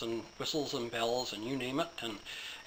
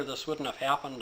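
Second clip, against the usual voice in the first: usual speaking voice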